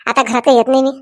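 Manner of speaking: usual speaking voice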